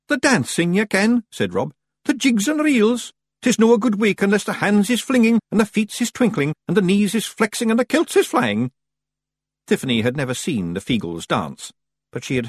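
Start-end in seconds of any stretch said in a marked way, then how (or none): 0.1-1.2 high Scots Feagle voice; high-pitched Scottish character voice; Feagle leader. A narrator's reading. 2.0-8.7 very high, lilting Scots voice; high-pitched Scottish Feagle voice; enthusing Feagle leader. A narrator's reading.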